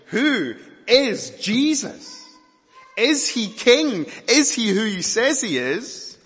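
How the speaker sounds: high, loud, slow questions; rhetorical question. Real, not acted. Preacher asking the congregation who Jesus is, loud and slow. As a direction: lift each question to the crowd. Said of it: "the real question".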